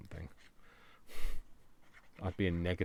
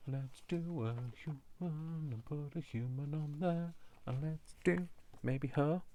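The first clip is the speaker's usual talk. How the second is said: sing-song voice; 8 semitones higher, slower than usual. Pretend. Playful sing-song choosing a stamp.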